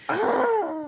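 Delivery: mock yelp. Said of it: "She goes".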